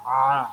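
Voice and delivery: a deep आ squeezed out from the middle of the throat; throat sound. Shown as a demonstration. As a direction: push आ from the throat as if biting an apple. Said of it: "इस तरह से". (गले से निकली आ की आवाज़)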